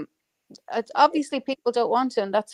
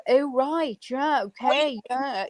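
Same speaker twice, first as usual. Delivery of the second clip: mock Dart accent; 5 semitones higher, slower than usual. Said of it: "this really weird accent, we call it the Dart accent".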